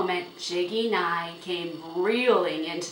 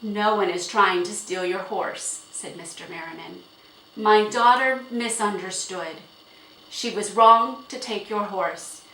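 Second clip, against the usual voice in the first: firm apology; 2 semitones higher, 8 dB louder than usual. A narrator's reading. Father firmly placates angry owner.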